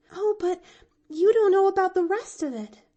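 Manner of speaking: put-on high, loud, fast and eager girl; excited child reminding him. A narrator's reading. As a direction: rush in with the good news. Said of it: "she reminded him eagerly".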